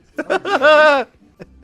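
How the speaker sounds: high, explosive burst of laughter; loud laugh. Real, not acted. Reactor cracking up at the cookies clip, loud high laughter.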